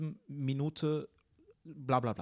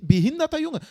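much higher and louder, bursting out in outrage; outraged outburst; 15 dB louder than usual. Real, not acted. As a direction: blurt the insult in full indignation. Ranting about the hateful commenter. (empörter Ausruf, viel höher und lauter)

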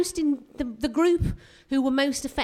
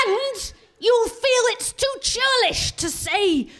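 excited; 12 semitones higher than usual. Real, not acted. Comic escalation.

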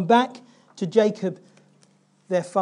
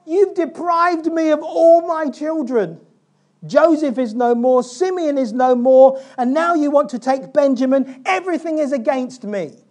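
self-pitying lament; 5 semitones higher, 7 dB louder than usual. Retold or imitated. Jacob laments to his sons in high, loud self-pity. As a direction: moan it like everyone has turned against you. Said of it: "'Cause I can do that really, really well".

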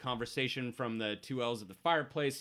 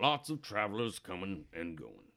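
put-on low, quiet old drinker; old-timer musing over his glass; old barfly; 4 semitones lower, 5 dB quieter than usual. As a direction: muse softly like an old regular. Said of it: "the old guy at the bar".

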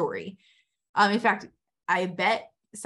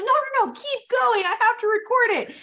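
excited high yelp; 14 semitones higher, 7 dB louder than usual. Retold or imitated. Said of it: "I'm like".

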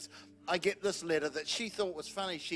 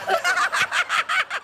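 high laugh; 10 dB louder than usual. Real, not acted. Husband laughs mid story.

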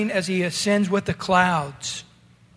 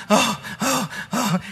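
freaking out; 2 semitones higher than usual. Retold or imitated. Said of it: "he's freaking out going".

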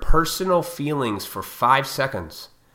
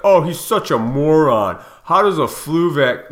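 louder, sneering mock voice; mocking critics; 3 semitones higher, 5 dB louder than usual. Vlogger parodies critics calling Trump a moron, louder sneer. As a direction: parody the know-it-all critics.

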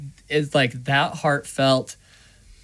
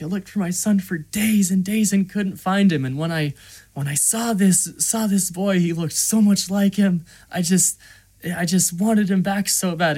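higher-pitched, distressed retelling; imitated broken-down plea; 7 semitones higher, 3 dB louder than usual. Gunsmith explaining the loss of his son, acted distress. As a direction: act out a grieving father's confession.

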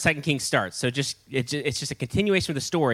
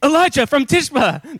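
high, loud shocked cry; exclaiming; 12 semitones higher, 6 dB louder than usual. A narrator's reading. King recognising Elijah from his description, loud exclamation. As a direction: burst out with alarmed recognition. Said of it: "the king exclaimed".

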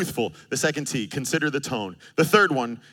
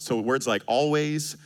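ordinary talk, nothing marked

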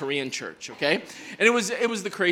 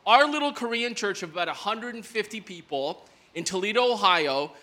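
ordinary talk, nothing marked

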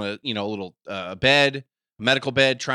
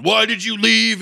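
loud, raised-pitch angry wrestler impression; mock yelling; 8 semitones higher, 5 dB louder, slower than usual. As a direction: yell it accusingly, as an act. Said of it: "Macho Man's yelling at Elizabeth".

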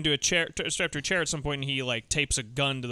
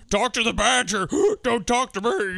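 louder, higher, slow threatening gangster; menacing thug; 7 semitones higher, 5 dB louder than usual. Retold or imitated. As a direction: threaten him theatrically. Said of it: "then are like".

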